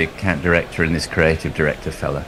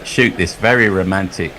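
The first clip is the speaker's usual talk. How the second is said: normal talking voice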